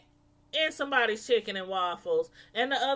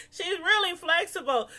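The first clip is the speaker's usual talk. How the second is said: repeated through laughter, higher; laughing; 6 semitones higher than usual. Shown as a demonstration. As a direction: say it again cracking up.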